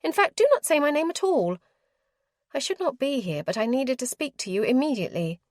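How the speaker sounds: a proper lady who must be heard now; urgent young lady. A narrator's reading. Clarissa voiced, urgent and tense.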